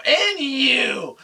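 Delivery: loud, high insult rant; furious tirade. Retold or imitated. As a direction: tear into a cheating girlfriend at full volume. Acted tirade at cheating girlfriend.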